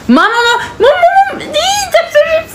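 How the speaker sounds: very high laughing cry for mom; laughing scream. Real, not acted. Daughter shrieks for her mother through laughter, very high laughing scream.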